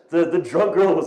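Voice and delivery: ordinary delivery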